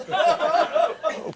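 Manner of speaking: high startled oh; surprised. Acted. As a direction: gasp it out high. Surprised reaction in story.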